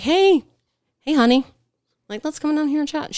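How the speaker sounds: high, sing-song coaxing call. Retold or imitated. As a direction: sweet-talk her down in a high voice. Coaxing woman down from tree.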